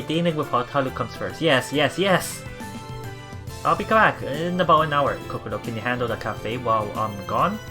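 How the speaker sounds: ordinary talk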